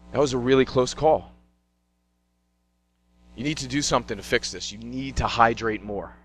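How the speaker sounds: low, soft grave doctor voice; serious doctor. Retold or imitated. Doctor warning the cramped athlete, low and quiet. Doctor speaks after hydration.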